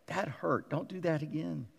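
a hint of a quiet scolding body. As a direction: quietly tell yourself off for the bump. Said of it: "my whole body was going".

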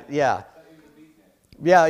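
normal talking voice

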